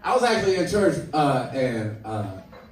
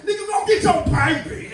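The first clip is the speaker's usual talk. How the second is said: incredulous inmate; 13 semitones higher, 3 dB louder than usual. Retold or imitated. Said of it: "dude on the stand was like".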